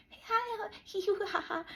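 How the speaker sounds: forced cheery greeting. Retold or imitated. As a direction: play your fake-bright self flubbing the start. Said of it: "So I'm like".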